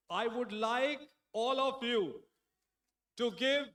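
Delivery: loud, slow, drawn-out emcee call; stage announcer. Acted. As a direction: build the big introduction. (मंच से ज़ोरदार धीमी घोषणा)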